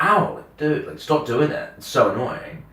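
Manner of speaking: mildly exasperated retold protest; annoyed protest. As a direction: object to having your hair pulled. Pained complaint about hair being pulled.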